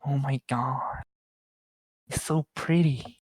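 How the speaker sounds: put-on soft, slow dreamy valley girl. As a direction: gush over villas, basic girl. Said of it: "See, you are good at that".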